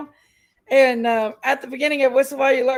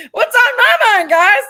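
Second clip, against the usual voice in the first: laughing; 10 semitones higher, 10 dB louder than usual. Real, not acted. Laughing at her own slip.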